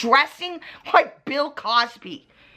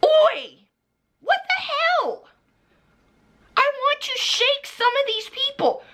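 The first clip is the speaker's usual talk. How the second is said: outraged outburst; 11 semitones higher than usual. Real, not acted. Venting fury at YouTubers who back a predator, outraged shout. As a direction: blow up in real fury. Exploding mid-rant.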